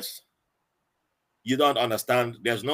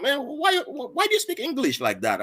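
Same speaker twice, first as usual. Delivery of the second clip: puzzled American recruit; 13 semitones higher than usual. Retold or imitated. Fellow recruit questioning his English, mock-incredulous. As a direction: poke at the way he talks.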